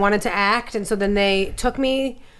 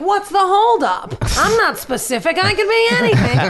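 impatient younger self; 10 semitones higher than usual. Retold or imitated. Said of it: "I was always going, like".

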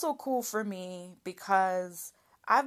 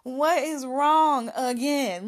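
louder, slower mock scold; exasperated family member; 5 semitones higher, 8 dB louder, slower than usual. Family asking the crying girl what's wrong, mock scold. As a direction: mimic the family's impatient nagging.

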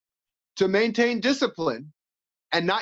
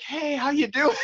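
higher, laughing mock greeting; mock friendly greeting; 8 semitones higher than usual. Fighter mocks greeting an opponent in the ring, laughing. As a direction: tease the idea of a polite hello. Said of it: "there is no, like".

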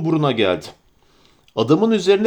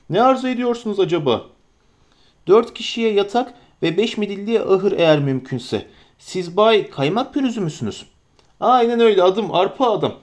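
higher, eager innkeeper voice at a quick clip; a flustered, fussing landlord; bustling innkeeper; 4 semitones higher than usual. Acted. Innkeeper Butterbur welcoming the hobbits, eager and fussy.

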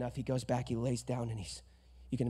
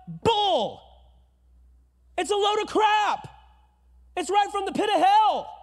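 loud scornful outburst; indignant shout; 10 dB louder than usual. Real, not acted. Pastor denouncing the lie to the congregation, shouting. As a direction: blast the lie away.

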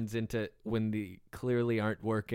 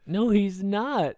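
high, drawn-out, louder denial; emphatic contradiction; 8 semitones higher, 4 dB louder, slower than usual. Real, not acted. Co-host disputing the bear-riding idea, emphatic contradiction. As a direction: flatly refuse it, stretching each word up high. Bickering over who rides the bear.

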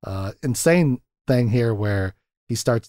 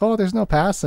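high, sweet Fargo-lady voice; put-on upbeat Fargo accent; 9 semitones higher than usual. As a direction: imitate a Minnesota lilt. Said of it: "she's become a Fargo character".

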